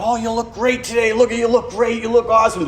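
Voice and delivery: over-the-top welcoming parishioner; Larry DiStefano. Retold or imitated. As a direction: beam out compliments to every newcomer. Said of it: "he'd meet people at the door".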